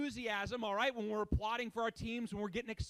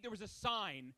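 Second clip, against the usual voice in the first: ordinary delivery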